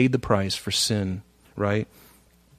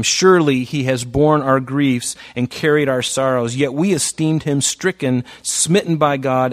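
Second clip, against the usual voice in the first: impassioned scripture reading; 2 semitones higher, 4 dB louder than usual. Acted. Reading Isaiah with preaching force.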